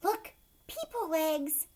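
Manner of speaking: high, slow delighted; delighted mother. Acted. Mother lifting the blanket, high slow delight. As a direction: coo over the baby. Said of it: "and said".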